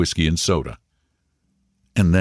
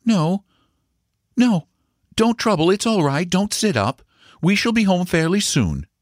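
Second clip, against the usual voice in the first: high voice, declining with some reluctance; reluctant mother; 13 semitones higher than usual. A narrator's reading. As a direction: wave the offer away, pitched high. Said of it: "said Paul's mother reluctantly".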